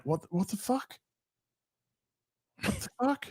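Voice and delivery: high, hushed incredulous squeak; baffled disbelief. Retold or imitated. Yorkshire streamer mimicking his reaction to being taken for a Londoner, baffled disbelief. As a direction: squeak it softly, as if dumbfounded. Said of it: "And I'm just like".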